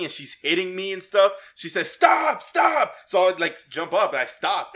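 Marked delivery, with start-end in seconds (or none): 1.9-2.9 high, loud mock scream; sharp high-pitched mock yelling; retold screaming. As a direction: imitate a partner crying out in protest. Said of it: "she starts screaming".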